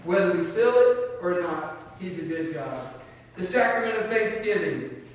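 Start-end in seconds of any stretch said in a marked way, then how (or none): 3.4-5.0 higher, louder solemn title; solemn closing blessing. Real, not acted. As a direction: proclaim the title, lifted and louder. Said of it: "stand with me this morning for the benediction".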